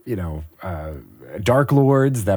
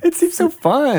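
excited; 4 dB louder than usual. Real, not acted. Excited squealing reply.

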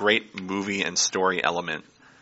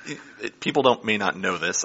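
ordinary talk, nothing marked

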